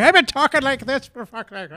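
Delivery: loud, high, hammed-up Irish accent; mock Irish accent. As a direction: burst into a cartoonish Irish brogue. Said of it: "If it was Irish food".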